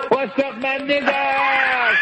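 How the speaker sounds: high, slow drawn-out greeting with a grin; playful mock shout-out; mock street greeting. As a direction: call it out high and slow, hamming it up. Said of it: "we run away and say".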